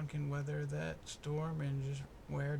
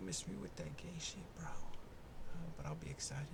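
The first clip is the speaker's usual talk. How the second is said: whisper; 7 dB quieter than usual. Real, not acted. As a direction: whisper it close to the mic. Whispered asides.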